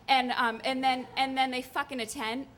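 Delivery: normal talking voice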